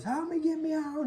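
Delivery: very high, put-on panicked voice; hammed-up high cry for rescue; mock plea for help. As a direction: squeal like a cartoon victim trapped.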